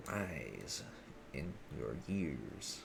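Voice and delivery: a slow, mock-wise drawn-out delivery; wise old man. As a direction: stretch the words like a wise elder.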